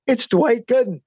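reliving a starstruck moment; starstruck exclamation. Retold or imitated. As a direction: mildly exclaim at spotting a star. Retells his amazed reaction on seeing star.

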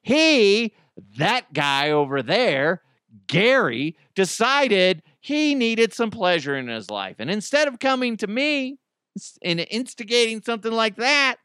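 loud, high-pitched indignant rant; acted pissed-off wife. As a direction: rant as the furious wife, hammed-up. Wife cuts in, publicly blaming Gary.

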